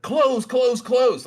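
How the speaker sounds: high, loud, urgent repeated pleading; frantic. Retold or imitated. Trader to his locked screen as the price moves, frantic. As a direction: yell it in a panic at the screen.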